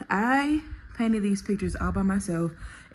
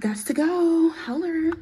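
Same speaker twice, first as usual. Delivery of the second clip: playful sign-off; 8 semitones higher, slower than usual. Pretend. Signing off playfully.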